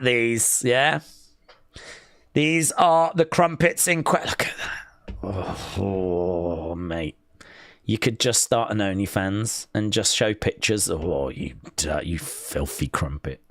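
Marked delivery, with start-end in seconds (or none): 4.3-7.2 hushed, breathless awe at food; marvelling at crumpets in a soft whisper; awed whisper. Real, not acted. 10.9-13.4 low mock-lustful growl at food; mock seductive. Mock-flirting with crumpets.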